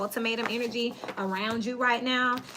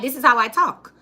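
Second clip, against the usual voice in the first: defiant retort; 3 semitones higher, 10 dB louder than usual. Retold or imitated. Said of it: "I'm like".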